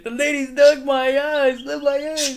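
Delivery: high, loud, slow wailing; hammed-up pained whine; pained quote. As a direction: wail with sprayed eyes. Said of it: "he gets sprayed in the eyes".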